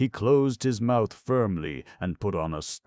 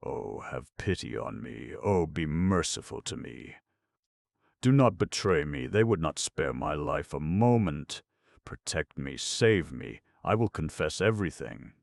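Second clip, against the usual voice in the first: plain reading voice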